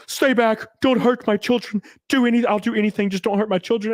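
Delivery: high-pitched mock pleading of a frail man. Frail man begging an intruder for his children, high mock plea. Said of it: "a frail dude that looks like".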